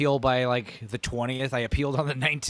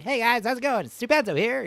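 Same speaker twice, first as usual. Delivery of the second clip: higher sped-up chipmunk voice; 10 semitones higher, 3 dB louder than usual. Retold or imitated. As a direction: sound like a sped-up tape. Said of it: "Like chipmunk, chipmunk voice".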